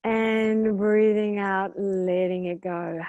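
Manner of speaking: measured meditation voice with long pauses between phrases; guided meditation. Real, not acted. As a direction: guide listeners into calm, stretching the breathing cues.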